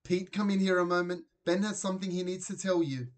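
high, loud call to the next room; mother calling her husband in from the living room. A narrator's reading. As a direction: summon him in excitedly. Mum calls Dad from room.

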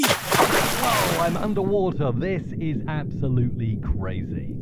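wobbly underwater voice. Shown as a demonstration. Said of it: "kind of wobbly sound to a voice".

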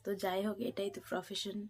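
very soft, low, near-whispered bedtime chat; speaking softly. Real, not acted. Vlogger to viewers beside stirring Soham, speaking softly. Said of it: "তো একটু আস্তে আস্তেই কথা বলছি".

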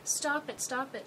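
mock scream at fighting parents, pleading; imitating a screaming child. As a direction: beg your parents to stop like a frightened six-year-old. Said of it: "The little girl just starts screaming again".

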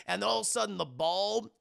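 normal talking voice

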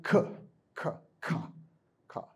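"K" and "Ka" breathed out softly, voiceless; soft voiceless K sounds; sounding out K. Shown as a demonstration. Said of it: "Is K a beautiful sound?".

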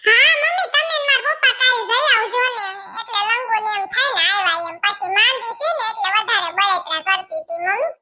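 high, put-on voice of a fretting daughter; young daughter voice. Daughter complaining to her mother about the scolding, high voice. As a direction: play the daughter, pitched well up, a little tearful near the end.

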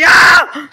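sudden loud yelp of pain; pained scream. Acted. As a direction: scream out as if hurt. Sudden pain, shouts a curse.

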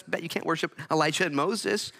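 ordinary talk

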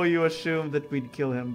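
normal talking voice

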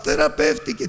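high, brief imitated shout of joy; mock joyful cry. Preacher imitating a joyful cry the prophet never gave, high mock exclamation. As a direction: act out the exclamation he never made. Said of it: "δεν άρχισε να φωνάζει".

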